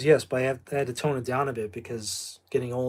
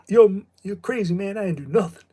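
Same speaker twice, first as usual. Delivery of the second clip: raised, high-pitched mock protest; hammed-up high protest of innocence; movie character protesting; 7 semitones higher, 6 dB louder than usual. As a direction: act out a film character loudly denying it.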